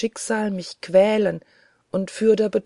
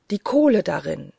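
plain reading voice

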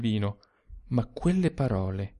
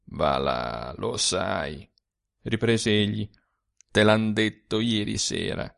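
a weary ghost sharing bitter secrets; ghostly old man; 6 semitones lower, slower than usual. A narrator's reading. Sturzi insists.

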